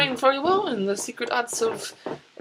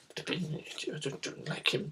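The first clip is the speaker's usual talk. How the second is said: soft mock grumble; muttered under breath; 6 semitones lower, 12 dB quieter than usual. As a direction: grumble it so he can't hear. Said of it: "I go".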